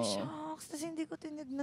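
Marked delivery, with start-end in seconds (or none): 0.0-0.4 dropped low and soft, an embarrassed "Shucks"; soft low groan of regret; guilty shucks. Retold or imitated. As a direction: mutter it in guilty realisation. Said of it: "realizing guilt".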